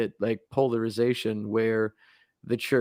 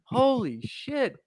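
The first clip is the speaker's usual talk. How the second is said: much higher, exaggerated contempt; exasperated Dracula; 12 semitones higher, slower than usual. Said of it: "And he's like".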